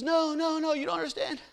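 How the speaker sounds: high, breaking with laughter at the punchline; hammed-up shocked neighbor. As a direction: squeal the twist half-laughing.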